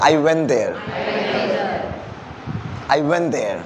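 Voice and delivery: "I went there" run together as one word; linked words. Shown as a demonstration. Teacher models for the class, "I went there" linked. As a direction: glide went straight into there. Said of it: "this is called word connection".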